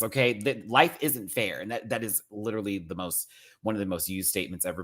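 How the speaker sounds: ordinary talk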